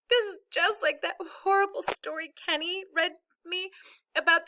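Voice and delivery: put-on high little-child wail; blubbering. A narrator's reading. Little sister pleading with mother for brother, blubbering. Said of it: "Joey blubbered out".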